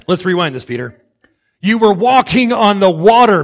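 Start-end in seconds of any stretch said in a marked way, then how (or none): 1.6-3.4 loud, higher-pitched disbelief; exasperated. Acted. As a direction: hammer it home as an acted rebuke. Acts Jesus exasperated with Peter.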